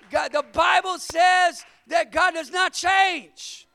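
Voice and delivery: full-force preacher building to God don't change; preaching shout. Real, not acted.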